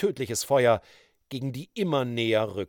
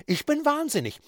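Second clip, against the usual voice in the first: raving woman; 10 semitones higher, 4 dB louder than usual. A narrator's reading. Georgine rages at the judge.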